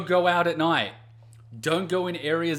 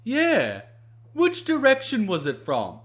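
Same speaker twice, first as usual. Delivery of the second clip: mock clueless cop; 9 semitones higher, 6 dB louder, slower than usual. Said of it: "cop on the phone".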